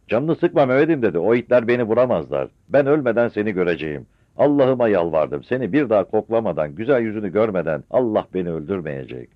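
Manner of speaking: high loud reassuring call; calling out reassurance. Acted. Mother calls loudly to reassure her son. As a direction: call across to calm him.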